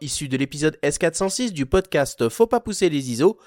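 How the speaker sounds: lively, lilting broadcast intro; podcast announcer. Acted. Announcer presents a voicemail segment from the podcast, announcer style. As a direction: announce the show with emphatic pitch swings.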